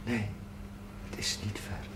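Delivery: hushed and low, a whisper; whispered news. Acted. Priest whispering good news to the grieving mother. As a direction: breathe the good news gently.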